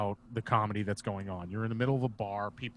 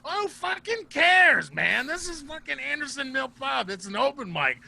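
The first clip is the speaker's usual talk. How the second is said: high, drawn-out shouting impression of a loud comic; big exasperated yell, imitated; Bob; 7 dB louder than usual. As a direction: holler at a friend to get over it. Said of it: "Bob's really loud".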